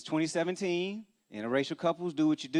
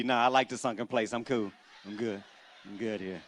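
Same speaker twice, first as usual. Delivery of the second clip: fading lower and softer, as if hypnotized; 6 semitones lower, 4 dB quieter than usual. Retold or imitated. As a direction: sink deeper into the trance with each line. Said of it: "[name] stirred his own tea".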